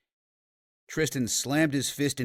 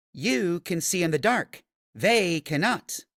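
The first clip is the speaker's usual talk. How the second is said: higher call across the dark room, slower at the end; shouted; 7 semitones higher, 4 dB louder than usual. Acted. Felicity calling to Tristan as she darkens the room, shouted. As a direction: shout the warning up from the chandeliers. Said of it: "she shouted".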